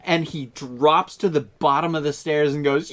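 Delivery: ordinary delivery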